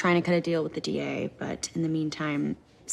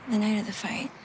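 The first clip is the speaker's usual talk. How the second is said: ordinary talk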